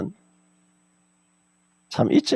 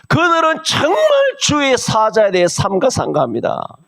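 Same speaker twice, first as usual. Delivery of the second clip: fervent preacher's shout; preaching shout; 7 semitones higher than usual. Real, not acted. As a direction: call it out from the pulpit.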